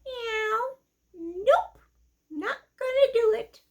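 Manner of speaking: high squeaky cat with a meow, saucy refusal; a sassy little cat who won't lift a paw; kitty voice. Acted.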